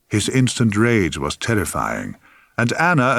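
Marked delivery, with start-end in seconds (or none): none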